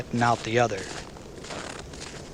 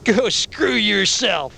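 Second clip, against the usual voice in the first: much higher, slow, louder snarl; snarling biker; 10 semitones higher, 6 dB louder, much slower than usual. A narrator's reading. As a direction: spit it out as a hurt biker. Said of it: "Charlie snarled".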